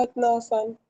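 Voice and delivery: usual speaking voice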